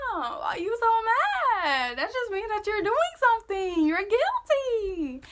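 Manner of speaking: high, loud, drawn-out teasing; stretched-out mocking accusation; joking taunt. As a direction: needle him in a slow, high mock whine. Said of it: "I'm just jokingly laughing".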